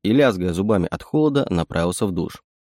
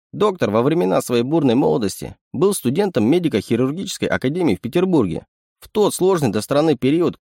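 lightly raised, teasing raccoon voice; a sly little animal sidekick; sly chittering raccoon; 5 semitones higher than usual. Acted. Raccoon explains.

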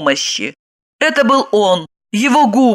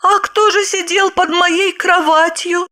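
confused mumble; 12 semitones higher than usual. Acted. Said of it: "растерянно бормотала она".

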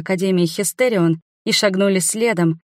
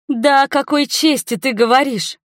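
higher-pitched outraged outburst; enraged roar; 7 semitones higher than usual. A narrator's reading. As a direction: roar back in indignation. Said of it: "взревела я".

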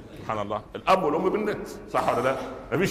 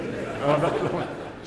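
laughing; 3 semitones higher than usual. Real, not acted. Laughs at his own anecdote.

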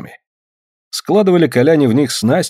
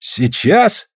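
higher, rising astonishment; amazed question; 4 semitones higher than usual. A narrator's reading. Afanasy is taken aback by Suль's plan, amazed question. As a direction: ask it with a surprised upward lift. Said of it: "изумляется Афанасий".

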